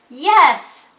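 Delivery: loud, slow excited call; Ben yelling. Acted. Teacher voicing Ben's line in a picnic story, yelling. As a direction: shout it out like Ben. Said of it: "yells Ben".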